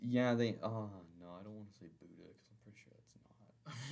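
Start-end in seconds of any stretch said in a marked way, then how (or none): 1.2-3.9 whispered aside. Real, not acted.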